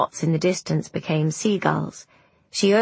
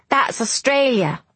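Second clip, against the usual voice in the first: put-on higher-pitched boy announcing news; excited boy; 6 semitones higher than usual. A narrator's reading. Boy tells the girl what the distant shore is, eagerly. Boy proudly names the land sighted.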